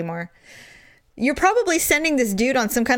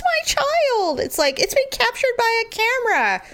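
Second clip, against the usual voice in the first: mock protest; 13 semitones higher than usual. Mom arguing about school photos, high mock protest. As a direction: stretch it high in exaggerated indignation.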